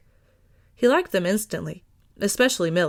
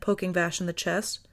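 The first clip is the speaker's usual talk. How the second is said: ordinary delivery, nothing marked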